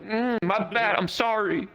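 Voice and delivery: high-pitched mock meekness; small high voice, acted apology; sheepish apology. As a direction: imitate yourself backing off apologetically.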